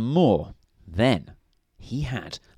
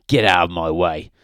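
a louder, growled snap; snapped; 4 semitones lower, 6 dB louder than usual. A narrator's reading. Mister Grumpy snapping at Mister Happy on the way home, louder snap. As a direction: push past him with the words. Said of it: "snapped Mister Grumpy".

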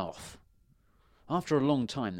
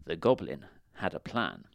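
ordinary delivery, nothing marked

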